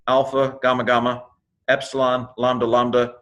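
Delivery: ordinary delivery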